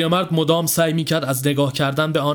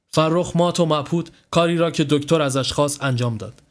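ordinary delivery

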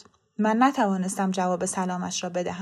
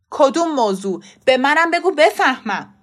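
loud, impatient demand; frustrated, raised-voice questioning; agitated demand; 4 semitones higher, 8 dB louder than usual. A narrator's reading. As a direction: insist on being let in on it. Father demands to be told.

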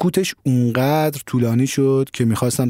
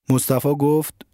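ordinary delivery, nothing marked

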